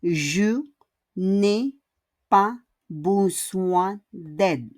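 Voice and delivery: each word spaced out slowly. Shown as a demonstration. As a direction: say it slowly for the learners. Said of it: "And the last is ne pas again". (dahan-dahan, isa-isang salita)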